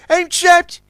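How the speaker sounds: high, loud cry of alarm; desperate cry. A narrator's reading. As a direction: cry out, cornered. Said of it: "cried Zug".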